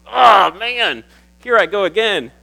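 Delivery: high, loud, choked-up break; a pastor's voice giving way to emotion; welling up. Real, not acted. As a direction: fight back real tears. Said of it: "deal with me crying now".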